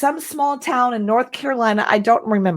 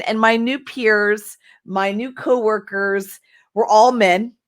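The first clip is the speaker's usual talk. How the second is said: usual speaking voice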